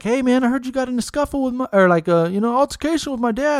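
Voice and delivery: high, fast mock-casual challenge; breezy but pointed; confronting brother. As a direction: brother Skylar confronting Cliff, lightly voiced. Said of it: "Skylar was like".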